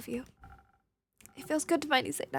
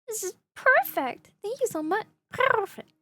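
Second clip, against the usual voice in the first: much higher and louder, slow and thrilled; delighted; 8 semitones higher, 5 dB louder, slower than usual. Acted. Character thanks the innkeeper for hot chocolate, delighted. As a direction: gush over the perfect cup.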